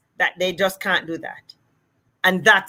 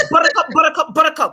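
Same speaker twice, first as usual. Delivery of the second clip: "buttercup" rapid-fire and higher; as fast as possible; 7 semitones higher, much faster than usual. Shown as a demonstration. Therapist corrects the host by demonstrating, rapid-fire. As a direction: run the syllables of "buttercup" together quickly. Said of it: "say it as fast as you can".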